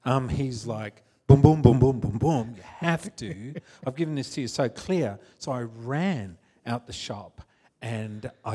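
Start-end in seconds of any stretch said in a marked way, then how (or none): 1.3-5.2 mock booming shouts, emphatic and punchy; insistent voice of God. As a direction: hammer out each boom like a drumbeat. God urging him, retold.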